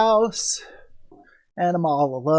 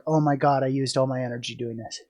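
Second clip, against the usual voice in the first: fast, much softer, winded; out of breath; 6 semitones lower, 5 dB quieter, faster than usual. Retold or imitated. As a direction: puff it out, no energy left. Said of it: "I'm just like".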